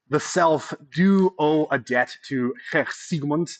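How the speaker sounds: slow, deliberately bad German accent; lightly put-on German accent, a mock Herr. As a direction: lecturer slips into a self-mocking German accent for Freud. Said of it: "that's the worst German accent in the world".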